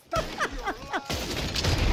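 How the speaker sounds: cracking up at the mishap; high laughing fit. Real, not acted. Laughing after accidentally pulling a knife on an NPC, high giggle fit.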